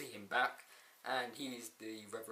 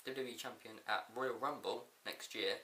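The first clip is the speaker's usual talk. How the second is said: usual speaking voice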